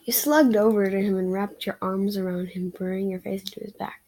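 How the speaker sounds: ordinary delivery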